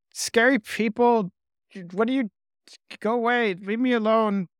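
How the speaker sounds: a cornered lizard squeaking; frightened lizard. Acted.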